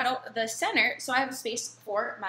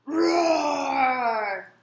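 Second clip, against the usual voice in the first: a big, loud roar, pitched up; ferocious roar; 5 semitones higher, 9 dB louder than usual. A narrator's reading. Child lion roars to impress teacher, loud roar. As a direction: roar like a young lion showing off. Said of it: "I let out a ferocious roar".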